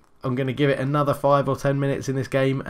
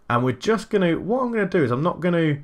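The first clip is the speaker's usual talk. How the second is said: ordinary talk, nothing marked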